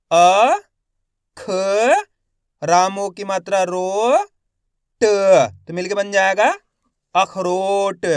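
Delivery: slow spelling drill, each syllable held with a rising end; stretched syllables for beginning readers; letter-by-letter sounding out. Shown as a demonstration. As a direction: sound out each letter, then blend the word. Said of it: "एक एक अक्षर का उच्चारण".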